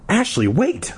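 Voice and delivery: high, urgent call; restrained shout to stop; crying out. A narrator's reading. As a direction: call out after someone, pitched high. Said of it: "I cried".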